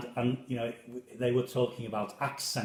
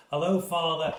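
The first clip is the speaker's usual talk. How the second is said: louder, higher called-out greeting; retold shout; 6 semitones higher, 7 dB louder than usual. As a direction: imitate a lad shouting across the street.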